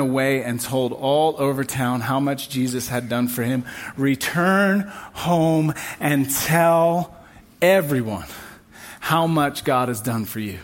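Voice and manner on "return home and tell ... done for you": "Return home and tell" drawn out very slowly, each word weighed; slow, weighty repeat. Shown as a demonstration. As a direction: let the verse sink in, word by word. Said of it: "verse said again slowly".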